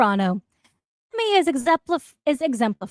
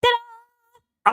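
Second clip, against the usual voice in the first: ta-da reveal; 10 semitones higher than usual. Acted. Reveal of new hats.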